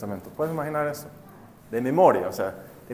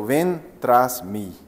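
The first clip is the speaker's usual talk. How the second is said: slow solemn call; 5 semitones lower, much slower than usual. Acted. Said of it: "Jesus's call".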